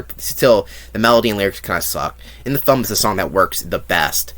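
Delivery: ordinary talk, nothing marked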